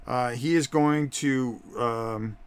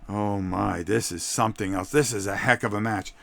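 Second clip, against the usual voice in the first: ordinary delivery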